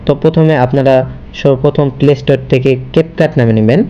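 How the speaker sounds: ordinary talk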